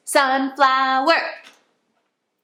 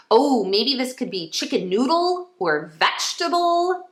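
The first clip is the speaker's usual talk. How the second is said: ordinary talk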